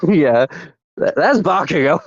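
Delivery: usual speaking voice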